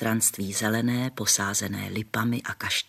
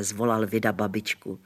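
ordinary delivery, nothing marked

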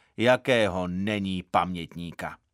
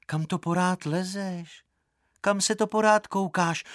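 higher-pitched, reproachful questioning; scolding; 8 semitones higher than usual. A narrator's reading. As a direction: narrate a girl telling someone off. Eva scolds the searching boy. (káravě, vyšším hlasem)

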